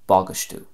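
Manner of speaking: "bagaistú" as BAG-ish-too; Ulster pronunciation. Shown as a demonstration. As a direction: stress the start of "bagaistú", end on U. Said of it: "a U sound at the end".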